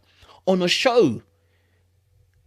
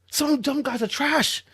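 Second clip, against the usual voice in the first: ordinary delivery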